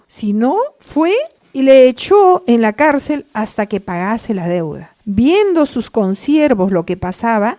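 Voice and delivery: loud, driving sermon delivery; preaching at full force. Acted. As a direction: thunder the parable to the congregation. (predicando con fuerza, en voz alta)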